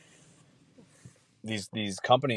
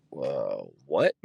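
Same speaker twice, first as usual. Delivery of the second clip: mock surprised customer; 8 semitones lower than usual.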